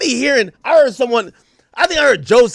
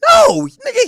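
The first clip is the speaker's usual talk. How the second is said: high loud no; 4 dB louder than usual. Real, not acted. Dismissing the critics' excitement, high loud retort.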